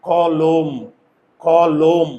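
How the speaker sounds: কলম in two parts, "kaw-lom", final m closed; teacher's careful "kaw-lom" for কলম; word pronunciation demo. Shown as a demonstration. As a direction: sound out কলম as kaw... lom, no vowel after m. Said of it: "আমি যখন শব্দ উচ্চারণ করেছি".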